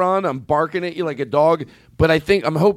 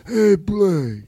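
Godfather impression; 5 semitones higher than usual. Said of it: "Godfather impersonations".

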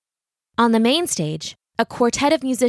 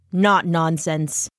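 slow, deliberate rebuttal; firm contradiction; 3 semitones lower, much slower than usual. Acted. Brahm contradicts Ichabod's scoffing, slow and firm. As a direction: counter the scoffer, taking your time on each word.

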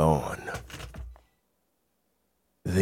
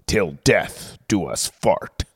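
smooth, measured movie trailer read; announcer voice selling a comedy sequel; trailer voiceover; 3 semitones higher than usual. Acted. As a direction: sell it like a big studio trailer.

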